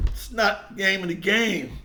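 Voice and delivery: ordinary delivery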